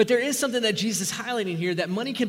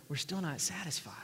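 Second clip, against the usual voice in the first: hushed whisper; 10 dB quieter than usual. Real, not acted. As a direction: whisper the question, barely voiced. Sermon climax, hushed rhetorical question.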